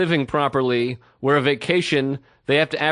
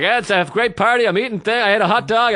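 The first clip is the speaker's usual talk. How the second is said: higher, louder nervous rambling; nervous babbling; 6 semitones higher, 4 dB louder than usual. Retold or imitated. Babbling nervously to a stranger at a party. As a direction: fill the silence with anything. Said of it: "firing off as much words as I can".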